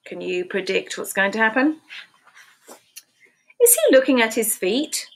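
ordinary delivery

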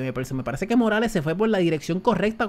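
ordinary talk, nothing marked

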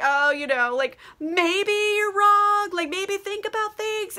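mock-timid, high-pitched drawn-out hedging; wimpy. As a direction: imitate spineless men tiptoeing round a criticism. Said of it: "like, pussy about things".

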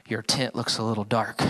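understated imitation of a traffic cop; police officer. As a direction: drop low and quiet like a stern cop. Acting officer at car window.

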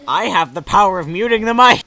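loud triumphant declaration; mock power shout. Gloating at the friend who cut him off, loud mock boast. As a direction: crow it at your friend.

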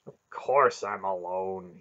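slow, loud, mildly scornful answer; snorting retort. A narrator's reading. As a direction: snort it out slowly and firmly. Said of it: "Yarblek snorted".

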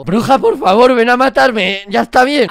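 loud fed-up plea to the witch; exasperated shout. Real, not acted. Gamer begging the witch to finish him off, loud exasperated shout. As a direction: yell it out in mock despair.

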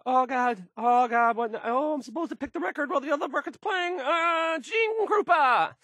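high, fast, frantic babble; squeaky panicked DJ rushing to find the next record; flustered panic. Acted. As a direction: scramble in a rising panic as the record runs out.

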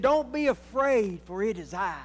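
a hint of Jesus calming his friends; voicing Jesus. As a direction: reassure the frightened disciples gently. Preacher voices Jesus calming disciples.

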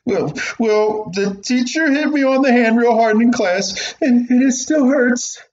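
put-on a little boy showing his sore hand; whiny hurt boy. Boy complaining to mother about the teacher.

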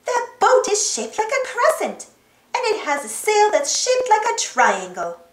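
high-pitched, eager explaining; Dora calling out. A narrator's reading. Dora shows Boots the crescent boat, eagerly. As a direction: point out the find eagerly. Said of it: "called Dora".

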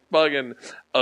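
normal talking voice

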